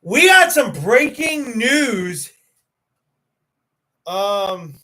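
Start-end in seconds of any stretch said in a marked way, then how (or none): 0.1-2.3 mock news anchor. Announcing news to chat.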